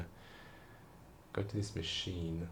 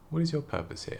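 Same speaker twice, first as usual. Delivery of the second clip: officer's question; 10 semitones higher than usual. Retold or imitated. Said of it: "And she was like".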